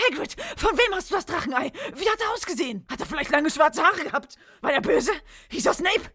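high, loud, urgent demands; urgent shouting. Acted. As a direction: fire questions at him, near shouting. Harry confronts Hagrid, nearly shouting.

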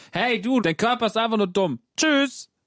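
high, bouncy teasing voice; mock cheeky taunt. Acts out telling a friend off.

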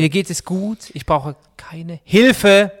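HILFE set apart, slow and loud; coded plea. Pretend. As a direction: pretend all is well while punching Hilfe. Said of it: "Das ist ein Code". (verschlüsselter Hilferuf mit lautem, gedehntem „Hilfe“)

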